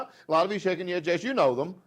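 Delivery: ordinary delivery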